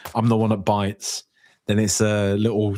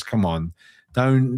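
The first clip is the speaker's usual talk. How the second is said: normal talking voice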